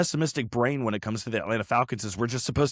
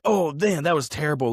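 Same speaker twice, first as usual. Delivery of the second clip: higher-pitched retold dismay; mock dismay; 7 semitones higher, 5 dB louder than usual. Imitating fans after World War One, mock dismay. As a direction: groan it out as the regretful crowd. Acts out fans' dismayed reaction.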